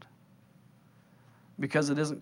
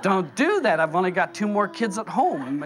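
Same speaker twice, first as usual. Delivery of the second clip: loud, raised-pitch mock pleading; exasperated protest; 4 semitones higher, 4 dB louder than usual. Parent begging the Lord not to stammer, loudly exasperated. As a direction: protest loudly, half laughing crowd-pleaser.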